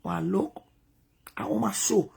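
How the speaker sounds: hushed, breathy whisper. Real, not acted. She whispers the opening line to herself. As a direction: drop to a whisper as if sharing a secret. Quiet aside before the story.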